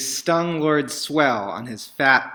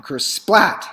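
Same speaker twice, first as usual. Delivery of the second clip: higher, louder splat; loud kersplat; 7 semitones higher, 7 dB louder than usual. A narrator's reading. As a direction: land it like a crash. Sound word of impact.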